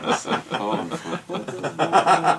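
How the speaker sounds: laughing, rising on bord-là; laughing punchline. Shown as a demonstration. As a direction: deliver it through a laugh. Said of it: "Et la question qu'on cherchait était".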